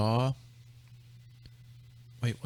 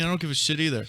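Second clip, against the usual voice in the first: ordinary talk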